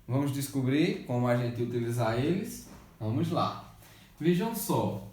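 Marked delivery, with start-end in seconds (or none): none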